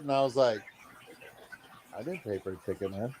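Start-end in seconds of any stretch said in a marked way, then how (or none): 1.9-3.1 low, hushed confession to a buddy; retold sheepish murmur; sheepish admission. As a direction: own up quietly, dropping the voice. Sheepishly admits no ticket was paid.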